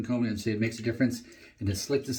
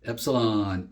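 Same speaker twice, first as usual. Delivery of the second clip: usual speaking voice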